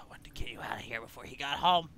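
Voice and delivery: urgent whisper, no voice at all. Acted. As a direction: breathe it out as a secret.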